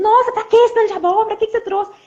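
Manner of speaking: exasperated impulse. Pretend. Wife voicing her first angry impulse at the pumpkins, exasperated. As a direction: blurt it out like an annoyed spouse. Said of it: "na hora da a vontade que a gente tem".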